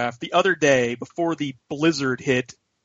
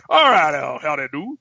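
mock dad voice, punched opening; dad impression. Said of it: "That's how he sounds".

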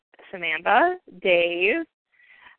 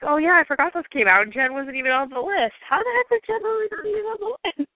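incredulous; 7 semitones higher than usual. Retold or imitated. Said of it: "I was like".

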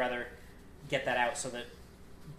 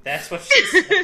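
loud joke; 16 dB louder, slower than usual. Acted. Punchline shouted over laughter.

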